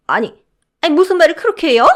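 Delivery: mother-in-law insulted by the guard; sputtering outrage. Acted. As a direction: splutter in offence.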